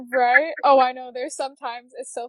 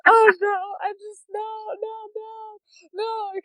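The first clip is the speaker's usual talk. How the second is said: high, fading mock panic; can't bear to watch the haircut; mock nervous mother-in-law; 10 semitones higher, 4 dB louder than usual. Mother-in-law watching a haircut, high mock dread. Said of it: "she'll just be like".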